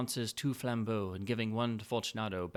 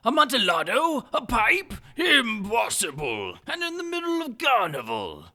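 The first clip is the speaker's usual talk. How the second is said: much higher, much louder incredulous outburst; astonished Fortunato; 13 semitones higher, 12 dB louder than usual. Acted. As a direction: cry out in disbelief, stretching impossible.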